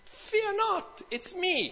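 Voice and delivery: high, soft acted call; gentle reassurance. Jesus calling to terrified disciples.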